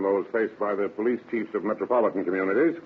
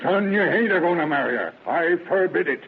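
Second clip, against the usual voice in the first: higher, firm forbidding; stern, raised paternal command; forbidding father; 6 semitones higher than usual. Acted. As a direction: lay down the law to your son.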